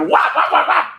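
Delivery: loud, rapid wah-wah chomps; punchy mimicked mauling sounds; imitated cat attack noises. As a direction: bark out quick mock attack noises.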